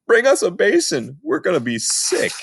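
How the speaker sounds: queasy mock shout for a basin. A narrator's reading. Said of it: "we start shouting".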